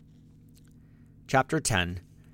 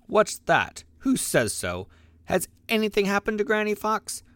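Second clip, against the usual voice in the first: alarmed, pitch jumping up high on the last question; a fox suddenly afraid for his granny; sudden fear; 10 semitones higher than usual. A narrator's reading. Reddy Fox asks the jay in sudden fear, alarmed and high. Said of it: "in a sudden great fear".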